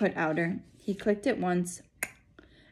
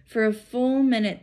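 usual speaking voice

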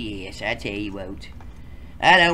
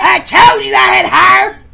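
whiny shout; 15 dB louder than usual. Acted. High whiny character yell.

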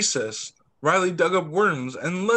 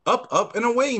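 excited; 7 semitones higher than usual. Acted. Samuel playing magic carpet.

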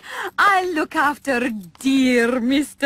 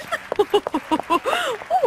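trilling diva laugh; 10 semitones higher than usual. Acted.

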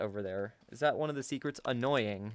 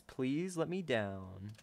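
ordinary talk, nothing marked

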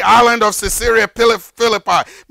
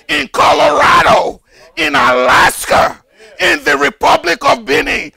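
full-force preaching; 6 semitones higher than usual. Acted. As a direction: preach it out to the congregation. Preaching about salvation in Yoruba.

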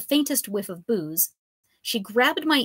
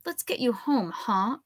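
hissed; 4 semitones higher than usual. Acted. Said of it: "I hissed".

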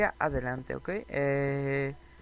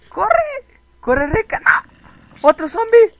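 loud, high cries of alarm; panicked shouting; 14 dB louder, slower than usual. Real, not acted. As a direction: shout a warning mid-attack. Avisa de otro zombi. (gritos de alarma, en pánico)

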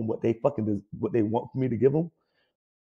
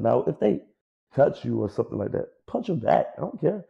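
ordinary delivery

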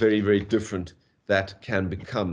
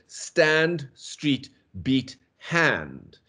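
"stand", "street", "beat", "hand" spelled out slowly; slow, spaced rhyme list; rhyme words; 3 semitones higher, much slower than usual. Shown as a demonstration. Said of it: "the rhyme scheme goes".

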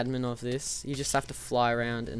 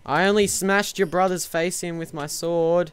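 usual speaking voice

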